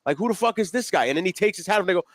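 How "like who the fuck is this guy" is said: high baffled crowd voice; sneering crowd impression; mock puzzled crowd. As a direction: act out a confused audience. Crowd reaction mocked in rant.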